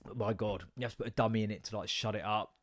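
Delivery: ordinary delivery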